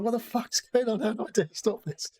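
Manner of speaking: high-pitched, bewildered protest; mock panicked disbelief. Player knocked down by angry pedestrians, mock panicked protest. Retold reaction to being knocked down.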